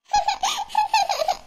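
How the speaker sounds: high-pitched sobbing; whimpering cry. Acted. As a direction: burst into acted sobs. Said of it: "ஏன் அழுவுறம்மா".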